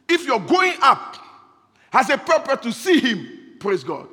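loud, high-pitched sermon push; fiery preaching. Acted. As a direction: preach it hard to the congregation.

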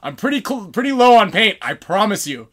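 reenacted holler to the enemy; baiting call. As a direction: call out to lure them closer. Baits enemies from the bushes.

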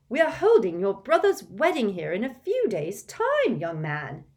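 loud, high stern mother; scolding mother. Acted. Mrs Weasley scolds Ron, loud and stern. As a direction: put him in his place.

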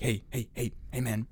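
softly imitated street hustler; furtive stranger. Guy approaching at the bus stop, quietly pestering.

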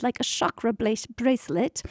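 normal talking voice

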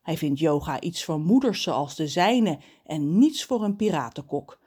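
ordinary delivery

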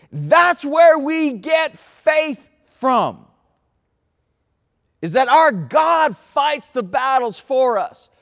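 slow, loud, rising preacher's cry; preaching at full force. Acted. Preacher urging the congregation, building to a loud cry. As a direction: build the crowd up to God fights for you.

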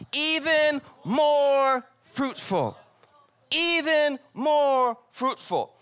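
slow, high, drawn-out stress on even; slow emphasis. Acted. Pastor driving home scripture to his congregation, slow and emphatic. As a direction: lean into every word. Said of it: "quoting scripture emphatically".

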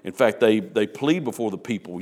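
usual speaking voice

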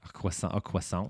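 croissant repeated softly as "kwess-ON"; affected French pronunciation. Retold or imitated. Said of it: "he says that unironically".

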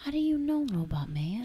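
high start, dropping on "Robot Man"; mock suspicious question. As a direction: playfully question the villain. Acted question to the villain.

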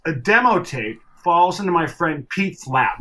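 ordinary talk

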